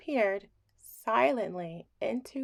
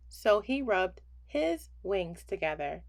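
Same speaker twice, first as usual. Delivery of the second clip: plain reading voice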